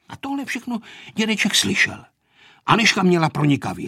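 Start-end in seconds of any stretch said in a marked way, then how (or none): none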